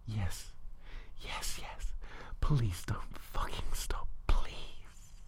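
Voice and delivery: whispered, urgent begging in character; lover's breathless plea. Acted. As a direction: plead in an acted whisper. Lover's pleading reply, voiced by narrator.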